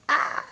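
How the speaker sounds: comic cry of pain; short hammed-up scream; mock scream. Suplexed victim hurting infinitely, mock scream.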